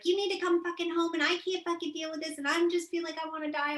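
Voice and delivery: high, loud mock rage on the phone; retold rage. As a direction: replay the old furious call, hammed up. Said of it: "In a rage, like the old self".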